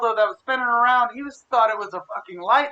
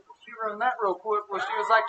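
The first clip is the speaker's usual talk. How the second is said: ordinary talk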